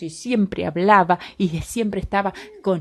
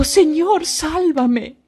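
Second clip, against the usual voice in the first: higher, louder cry for rescue; Peter's cry for help; 7 semitones higher, 5 dB louder, much slower than usual. Acted. Sinking Peter calls to Jesus, a mock desperate cry. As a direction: call out to be saved while sinking. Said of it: "Entonces le dijo".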